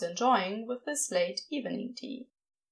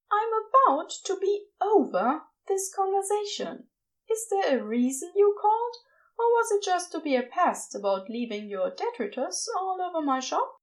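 higher, huffy voice; annoyed Aziraphale; 7 semitones higher than usual. A narrator's reading. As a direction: sound fed up but fond.

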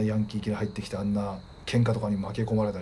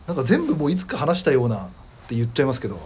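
normal talking voice

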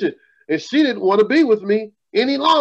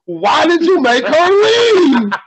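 high, slow, drawn-out plea; a friend's pleading question, imitated; imitating friend's whine; 7 semitones higher, 3 dB louder, slower than usual. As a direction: mimic the friend's plaintive question, stretching leave. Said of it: "asked him".

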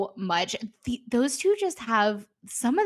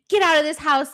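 loud, higher hammed-up order to leave; over-the-top yell; 5 semitones higher, 5 dB louder, faster than usual. Brother overdoing it to throw his friend out, hammed-up loud yell. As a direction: play it big, throw him out of the house. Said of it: "he's over the top".

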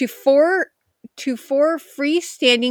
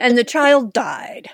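slow, solemn; mock grave. Shown as a demonstration. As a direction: weigh each word gravely. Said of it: "I sound so blasé about".

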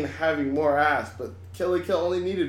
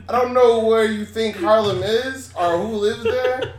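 laughing; 7 semitones higher than usual. Real, not acted. Laughing retort about Harlem.

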